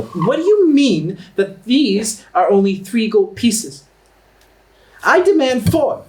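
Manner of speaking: high, loud indignant haggler; Astra. Acted. Offended seller haggling over gold pieces, high and indignant. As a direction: demand it shrilly, drawing out the outrage. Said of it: "you can hear Astra go".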